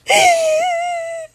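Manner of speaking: loud squealing laugh; high burst of laughter. Real, not acted. Big laugh.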